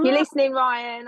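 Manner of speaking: mock announcement. Woman jokingly addressing her celebrity crush, mock announcement. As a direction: call out to an absent crush in jest.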